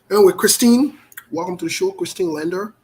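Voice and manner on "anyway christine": calling out. Real, not acted. Calling out a caller's name.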